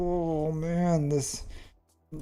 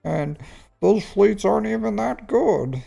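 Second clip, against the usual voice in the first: high pleading whine; hammed-up begging viewer; whiny imagined viewer; 6 semitones higher than usual. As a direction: plead like an addicted player. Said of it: "You're like".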